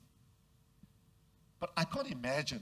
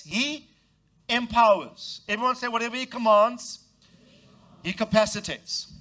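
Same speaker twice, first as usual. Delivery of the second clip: ordinary talk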